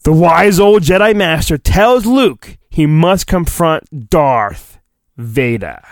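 usual speaking voice

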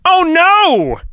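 high, hammed-up gasp of alarm; dramatic oh no. Reading a kids' book aloud, hammed-up alarm. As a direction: play up the danger for the listener.

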